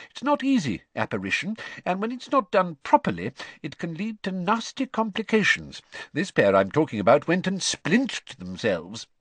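lightly voiced chatty dad; easygoing father explaining; cheery father voice. A narrator's reading. Father figure explains calmly.

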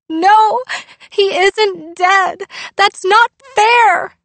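high, loud, slow wailing protest; crying out. Acted. Girl pushes her dad away, crying it's unfair. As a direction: wail it through tears. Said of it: "she cried".

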